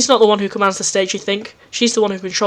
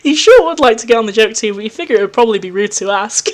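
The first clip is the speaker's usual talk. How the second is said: ordinary talk, nothing marked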